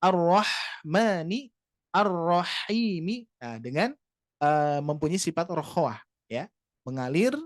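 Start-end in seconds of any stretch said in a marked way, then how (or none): 0.0-3.3 Ar-Rahmani, Ar-Rahimi chanted slowly with a breathy throat ha; Quranic recitation. Shown as a demonstration. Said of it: "huruf yang ha ini".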